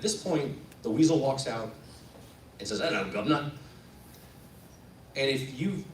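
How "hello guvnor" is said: lightly done stage Cockney; a hint of bad Cockney greeting; Cockney accent. Weasel walking out on stage, light Cockney. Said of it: "we spoke with terrible Cockney accents".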